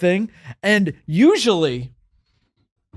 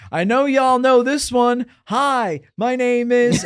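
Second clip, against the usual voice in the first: high, loud stage call to the crowd; 9 semitones higher, 4 dB louder, slower than usual. Retold or imitated. Rapper working a festival crowd, high raised call-out. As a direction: hype the audience into a call and response.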